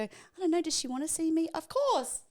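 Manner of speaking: sing-song second guessing; doubting friend. Retold or imitated. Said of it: "Your body will actually go".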